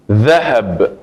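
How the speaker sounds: ذهب with a clear open "dha" at the start; fatha on dhal. Shown as a demonstration. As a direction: stress the opening dha of ذهب. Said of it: "Y tiene fatha porque digo the". (ذهب con fatha clara en la dhal)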